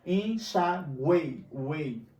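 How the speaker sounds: Enxaguei said very slowly, stretching the vowels; slow right down on Enxaguei to show the three vowels; drawn-out demo word. Shown as a demonstration. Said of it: "encontro de três vogais na mesma sílaba".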